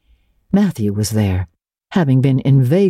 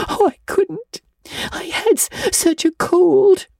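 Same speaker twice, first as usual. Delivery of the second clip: put-on tearful girl. A narrator's reading. Anne in tears.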